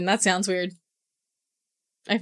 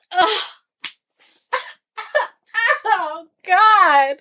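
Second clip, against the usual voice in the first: pained cry; 12 semitones higher, 5 dB louder, much slower than usual. Real, not acted. Reacting to sad scene.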